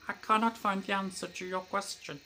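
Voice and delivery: high, tinny voice-assistant impression; tinny robot voice. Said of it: "it was like".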